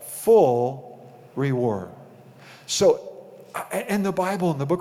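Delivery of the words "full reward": Full reward said very slow and low; a slow, low, weighty Full reward; stress on Full reward. Shown as a demonstration. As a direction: draw out Full reward like an answer. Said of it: "you could receive your what?".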